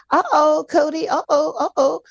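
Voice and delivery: high, teasing uh-oh alert; playful uh-oh. Real, not acted. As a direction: sing out a playful uh-oh. Pledge arrives, playful alarm.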